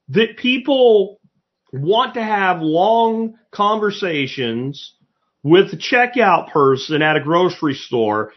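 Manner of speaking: normal talking voice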